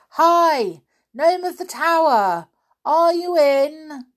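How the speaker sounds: high, loud hail up a tower; calling out. A narrator's reading. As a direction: shout to someone far inside, drawing words out. Binky calls into the tower.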